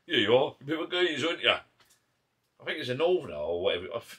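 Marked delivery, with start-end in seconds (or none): 0.1-1.5 high, loud teasing geezer voice; mocking bloke. Mimicking the Northerner teasing him in Ibiza, high and loud. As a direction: tease him like a cheeky lad. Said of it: "he's trying to be funny".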